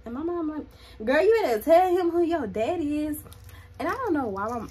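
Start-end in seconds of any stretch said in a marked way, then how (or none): 1.0-3.2 loud, high, sassy mom voice; bossy mom. Retold or imitated. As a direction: belt it out like a fired-up mom. Mom retold, loud and bold.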